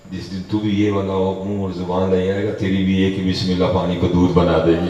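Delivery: building to a loud, preaching climax; emphatic pronouncement. Retold or imitated. Master promising his disciple the same power, rousing loud pronouncement.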